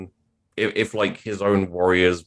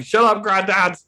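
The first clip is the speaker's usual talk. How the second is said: yelling back; 12 semitones higher, 5 dB louder than usual. Retold or imitated. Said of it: "And you're like".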